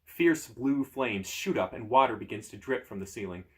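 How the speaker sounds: ordinary delivery, nothing marked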